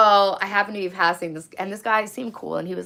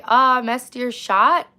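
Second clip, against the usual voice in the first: playful slow tease; 6 semitones higher, 8 dB louder, much slower than usual. Acted. Reading chat playfully.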